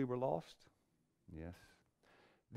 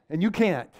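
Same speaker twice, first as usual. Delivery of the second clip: ordinary delivery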